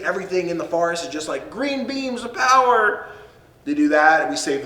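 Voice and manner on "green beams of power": slow, higher, drawn-out grandeur; mock epic. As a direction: stretch it out like a trailer voice. Mock epic description of forest.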